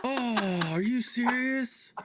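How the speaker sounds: high, slow, incredulous; mock disbelief. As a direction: ham up your own shock. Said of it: "I just look at him like".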